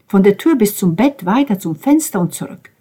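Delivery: ordinary delivery, nothing marked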